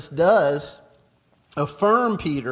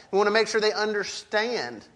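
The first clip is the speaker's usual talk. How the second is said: ordinary delivery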